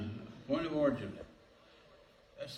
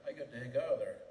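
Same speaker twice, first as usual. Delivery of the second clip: ordinary delivery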